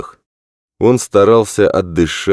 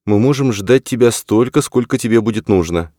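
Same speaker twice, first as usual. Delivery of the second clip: higher-pitched mother's voice, lightly acted; patient mother; 7 semitones higher than usual. A narrator's reading. Mother tells Tommy they can wait, higher and reassuring.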